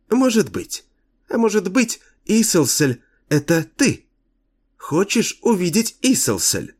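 put-on high coy childlike voice; cartoon princess. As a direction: tease coyly like a little girl. The girl teases Kane.

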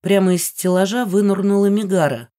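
ordinary delivery, nothing marked

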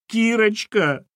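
high-pitched imploring cry; wailing cry. Acted. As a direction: cry out for rescue. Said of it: "возопила Валентина".

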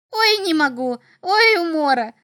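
high, loud imp, cracking up; hilarious little devil. A narrator's reading. Little devil looks at drawings in the sand, in hysterics. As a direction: squeal as if helpless with mirth. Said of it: "Вдруг чертёнок расхохотался".

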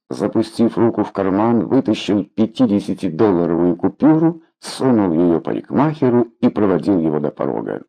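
plain reading voice

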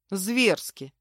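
ordinary delivery, nothing marked

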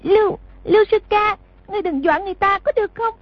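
put-on high, timid girl's voice pleading; trembling young girl. A narrator's reading. Young girl begs her senior brother to stop scaring her, high and fearful. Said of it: "rung lên nói".

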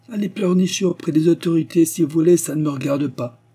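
high, loud, scornful dismissal; brutal brush-off. A narrator's reading. Pastor quotes the police chief's rude refusal, brusquely. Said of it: "c'est ainsi que ce sbire s'exprima".

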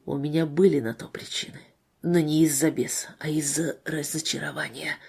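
low, soft and subdued; hushed, painful admission; whispered confession. A narrator's reading. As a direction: let it sink to a hush. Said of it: "будто если бы он сказал это громче".

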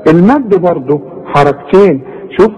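normal talking voice

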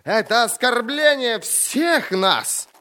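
very high, drawn-out protest; outraged roar. A narrator's reading. Lord protesting on behalf of the council, roaring. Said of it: "взревел лорд Брок".

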